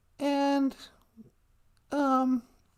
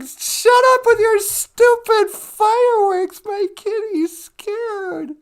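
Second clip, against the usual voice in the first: high, slow, drawn-out scolding; acted indignant rant; 6 semitones higher, 6 dB louder, slower than usual. Cat owner scolds the fireworks for scaring kitty, indignant. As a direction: start loud, then ease off into pleading.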